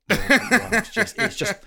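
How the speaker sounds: high, laughter-choked words; laughing too much to finish; breaking up laughing. Real, not acted. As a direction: lose the sentence to giggles. Host cracks up at own remark.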